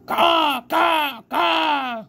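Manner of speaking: high, loud crow cawing; screaming crow. A narrator's reading. The crow screams in the rabbit's ear, high and loud caws. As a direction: caw like a big angry crow. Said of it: "screamed a dark message".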